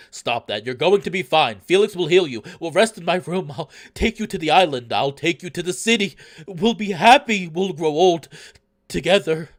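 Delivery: loud pleading promises, climbing in pitch; desperate denial. Acted. Amicus in desperate denial, acted.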